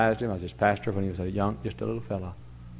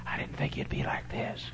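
whispered low and soft under the breath; a hushed, wondering aside from the back seat; 8 semitones lower, 10 dB quieter than usual. Retold or imitated. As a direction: murmur it in quiet amazement. A boy in the back seat marvels.

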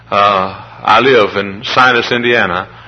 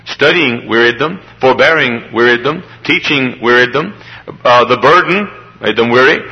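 normal talking voice

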